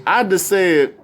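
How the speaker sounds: ordinary delivery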